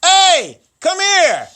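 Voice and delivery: high, loud holler across a playground; mom calling out. Retold or imitated. Host imitating his mom calling him from the playground, high holler. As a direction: yell for your kid to come, drawn out. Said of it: "like my mom used to do".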